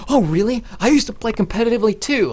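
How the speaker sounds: put-on girl's voice. Girl telling him she played competitively, high and eager.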